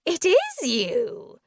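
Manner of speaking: high, loud, drawn-out glee; mad delight. A narrator's reading. Prisoner fixes on the narrator, madly delighted. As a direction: crow it slowly with delight. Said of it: "crinkled with delight".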